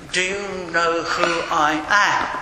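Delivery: slow, lightly mocked pomposity; imitating an entitled traveller; self-important. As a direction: draw it out like someone expecting to be recognised. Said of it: "the sort of person who says".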